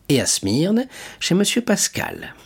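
ordinary delivery, nothing marked

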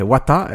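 "water" said loud in mock gangster English; gangster pronunciation. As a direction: exaggerate "water" like an American gangster. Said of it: "il fallait parler comme ça".

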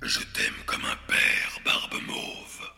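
whispered giant voice; dim giant. Acted. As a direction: breathe it like a simple giant. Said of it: "dit le géant".

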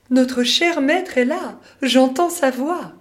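high, loud, joyful exclamation; excited cry. Acted. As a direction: call out delighted. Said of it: "s'écrie".

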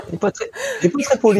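high-pitched words through laughter; laughing. Real, not acted. Teasing co-host, laughing.

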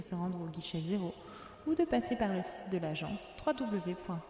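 calm boarding-call announcer voice; airport announcement. Acted. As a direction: read it like a terminal PA. Podcast opens as boarding call. (annonce d'aéroport)

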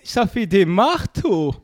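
put-on slow, high Lorraine accent. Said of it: "connaissez-vous ce bon mot lorrain".

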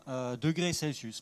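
ordinary delivery

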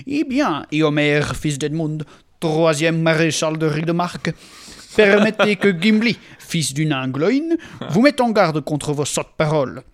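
put-on loud angry dwarf, Walloon accent; defiant Gimli in a Walloon accent. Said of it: "Gimli Wallon".

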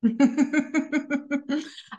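light real laugh; a short amused laugh before the joke. Real, not acted. A host laughing with her guest about soreness, light laugh.

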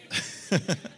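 a short airy real laugh at his own joke; laughing at the punchline of his own story; breathy laugh. Real, not acted. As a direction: let out a quick breathy chuckle.

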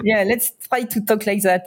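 playful French-accented English; English spoken with a jokey French accent. Shown as a demonstration. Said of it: "with a French accent".

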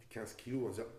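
ordinary talk

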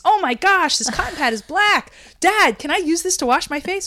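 high, loud amazed exclamation; thrilled, like finding something wonderful; excited discovery. Retold or imitated. Retelling her discovery with the cotton pad, loud amazed excitement.

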